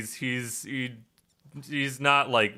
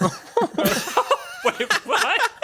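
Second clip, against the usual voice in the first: surprised disbelief; 12 semitones higher, 3 dB louder, slower than usual. Real, not acted. GM surprised at player.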